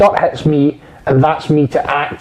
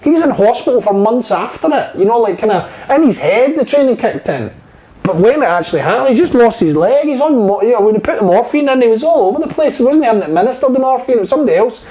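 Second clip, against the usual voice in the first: retold explanation; 8 semitones higher than usual. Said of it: "He says".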